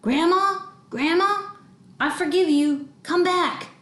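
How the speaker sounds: high, drawn-out calling; shouting into a hole. Acted. Child calling lost grandma down the sewer, shouting. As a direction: call out to someone far below. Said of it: "cupping my hands and shouting".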